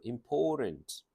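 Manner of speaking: important run together as im-POR-ent; English pronunciation. Shown as a demonstration. Teacher modelling English for students, run together. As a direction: say important with a long second beat, no final vowel. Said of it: "이런 식으로".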